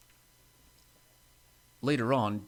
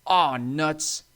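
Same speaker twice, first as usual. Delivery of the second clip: loud, higher American exclamation; frustrated cry; 5 semitones higher, 8 dB louder than usual. A narrator's reading. Stanley as he comes off the tracks, frustrated cry. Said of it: "he cried".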